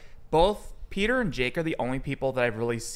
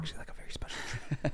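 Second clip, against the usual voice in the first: fast, playful unvoiced whisper; giggly prank whisper; 13 dB quieter, much faster than usual. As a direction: rush a teasing whisper. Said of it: "It's A S M R now".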